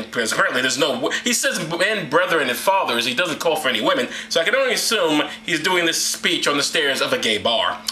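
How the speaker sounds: normal talking voice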